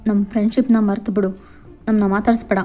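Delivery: ordinary delivery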